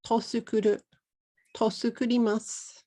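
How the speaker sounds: "Tosukuru" with a vowel pushed in after the t, to-su-ku-ru; "Tosukuru", t split off as an extra "to" syllable; extra vowel added. Shown as a demonstration. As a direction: say "Tosukuru" like a foreigner adding a vowel before su. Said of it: "I added a jama iranai boin to tsukuru".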